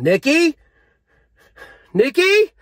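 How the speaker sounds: high, loud call of a name. Real, not acted. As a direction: shout the name up and rising. Calling someone after first hit.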